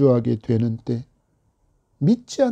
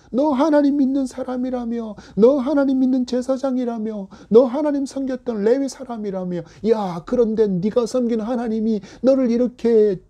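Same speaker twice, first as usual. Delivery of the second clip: higher, sneering jeer, question after question; mocking taunt; 5 semitones higher than usual. Neighbours jeering the exiled Levite, sneering taunt. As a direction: scoff at his faith like a bully. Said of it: "조롱하는 말이".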